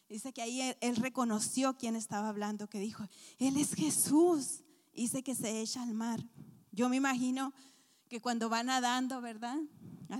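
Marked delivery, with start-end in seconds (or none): 3.4-4.6 slow, loud, amazed cry; awed recognition. Acted. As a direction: realize who it is and say it with wonder. Peter recognizes Jesus.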